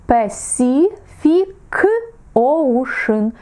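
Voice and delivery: Pacific said slowly, each c sounded apart; slow careful pronunciation. Shown as a demonstration. Teacher slowly modelling Pacific Ocean for students. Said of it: "у трьох випадках вона буде читатись по-різному".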